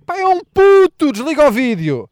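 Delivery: high, loud, drawn-out disbelief; incredulous outburst. Acted. Comedian realizing the guy is a kid, shocked and loud. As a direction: blurt it out in shock.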